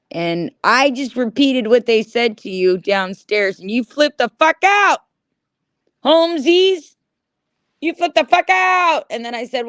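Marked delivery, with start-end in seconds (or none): 3.6-9.1 heated. Real, not acted. Angry retelling to chat.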